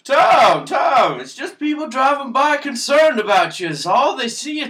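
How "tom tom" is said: loud call. Acted. Calming a raging friend.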